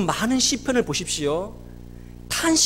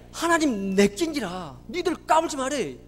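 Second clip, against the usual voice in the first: slow, emphatic Busan-accented declaration; Busan dialect. Shown as a demonstration. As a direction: draw out the Busan dialect like a proud challenge. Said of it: "부산말로 어떻게 표현하는지 아십니까?".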